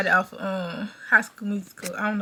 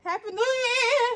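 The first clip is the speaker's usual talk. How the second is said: much higher, squealing happy new year; excited cheer; 9 semitones higher, 6 dB quieter than usual. Real, not acted. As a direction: burst into mom's room cheering. Greeting mom at midnight.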